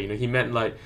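ordinary talk

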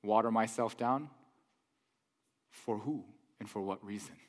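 low, quiet challenge; unbothered Jesus. Acted. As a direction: answer coolly, dropping pitch and volume. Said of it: "I imagine he'd be like".

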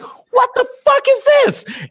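high, drawn-out mock shriek; freaked-out disbelief. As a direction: squeal it slowly in hammed-up shock. Retelling his shock at the cartoon.

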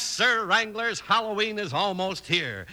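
ordinary talk, nothing marked

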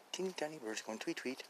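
very soft, tiny voice; tiny hush. Pretend. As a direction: whisper it delicately, as if not to break silence. Describing faint birds in silence.

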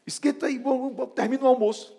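high and loud, hurrying; urgent excitement. Retold or imitated. Urging his wife to finish lunch, high and loud. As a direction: rush her to get lunch ready.

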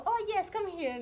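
chirpy imitated foreign helper; local answering in English. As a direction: imitate an obliging shop local switching to English. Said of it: "no me contestó en coreano".

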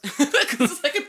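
ordinary delivery

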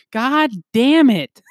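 high, slow exasperated groan; exasperated Morty. Retold or imitated. Retelling Morty trapped again, high drawn-out groan. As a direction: drag out the curse like a beaten Morty. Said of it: "Morty's like".

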